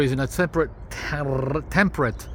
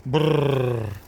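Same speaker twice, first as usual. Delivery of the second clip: shivering brrr from the cold; teeth chattering. Real, not acted. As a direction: lips trilling a cold brrr. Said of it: "It's so cold, guys".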